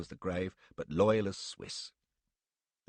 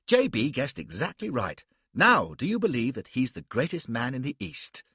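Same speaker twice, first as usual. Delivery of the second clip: put-on louder, jubilant young officer; louder, delighted and eager; excited; 3 semitones higher, 6 dB louder than usual. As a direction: crow over the good news. Stuart jubilant about the news.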